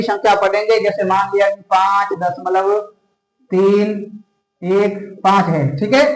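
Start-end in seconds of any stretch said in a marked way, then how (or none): 1.7-5.5 "पाँच दशमलव तीन एक पाँच" with each digit separate, pauses between. Shown as a demonstration. Teacher showing students how decimals are read, "तीन एक पाँच" digit by digit. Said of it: "राशि एक एक करके पढ़ेंगे".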